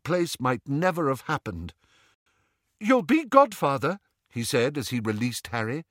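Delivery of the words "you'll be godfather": high, eager invitation; elated. A narrator's reading. New father making his friend godfather, elated. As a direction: ask it bursting with joy.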